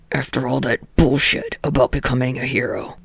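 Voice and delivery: low angry growl. A narrator's reading. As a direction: growl it with contempt. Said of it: "Katsuki growled".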